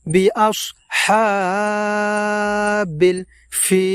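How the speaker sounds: বিআসহাবিল with the হা held long; long vowel drawn out. Shown as a demonstration. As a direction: stretch the হা in বিআসহাবিল slowly. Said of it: "টান দিয়ে লম্বা উচ্চারণে এইভাবে পড়ব". (বিআসহাবিল, হা লম্বা টান দিয়ে)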